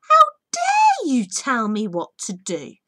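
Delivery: loud, slow, seething outburst; Lady Boulder rushing at the girl in a rage; raging cry. A narrator's reading. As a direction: spit it out in fury. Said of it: "Lady Boulder cried".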